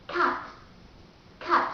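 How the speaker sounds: cart with a silent R, British; British cart, drop the R; British accent. Shown as a demonstration. Teacher explaining the silent R, British accent. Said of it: "The R sound is silent".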